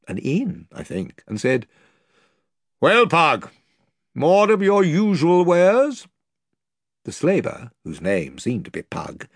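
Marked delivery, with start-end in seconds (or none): 2.8-6.1 higher, louder, slow lordly voice; a fine lord eyeing the goods; bearded lord. Acted. The lord asking Pug about his slaves, lordly.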